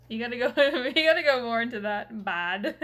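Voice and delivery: loud, laughing mock Canadian hockey bro; laughing Canadian hockey guy accent. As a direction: push the Canadian hockey accent further, giggling. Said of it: "That's Canadian for sure".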